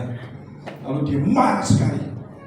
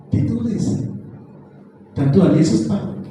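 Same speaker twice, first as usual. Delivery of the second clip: ordinary talk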